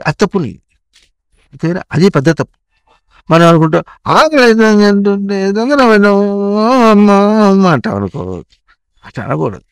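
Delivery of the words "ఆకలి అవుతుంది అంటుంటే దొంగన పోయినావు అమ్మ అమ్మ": imitating a child's loud complaint; mock hungry wail. Acting out a hungry child wailing.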